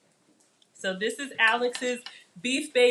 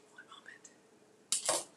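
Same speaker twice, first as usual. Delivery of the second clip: softly whispered; whispered aside; 14 dB quieter, much slower than usual. Real, not acted. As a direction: whisper it under your breath. Aside while cutting.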